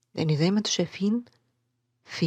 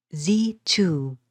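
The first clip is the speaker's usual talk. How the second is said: "Thee" unstressed, "too" stressed; stress on second word; 4 dB louder than usual. Shown as a demonstration. Teacher showing an iambic foot, stress on "too". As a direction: separate the words and land on "too". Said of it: "is unstressed".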